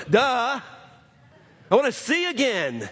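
high, sarcastic duh of the obvious; mock obvious. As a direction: play it like it's obvious, a bit cheeky. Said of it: "I might go".

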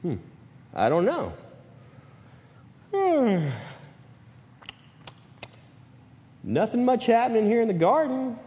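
mock-puzzled musing, mostly pitched high; idle, clueless disciples. Disciples unsure what to watch for, hammed-up idle puzzlement. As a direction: play a clueless lookout, humming and wondering. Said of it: "what they did was like".

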